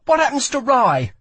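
high, loud, snapped question; angry snap. A narrator's reading. Lila to the dying Kel about Rai, snapping. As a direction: demand it sharply, high and loud. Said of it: "she snapped".

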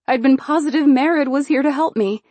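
ordinary delivery, nothing marked

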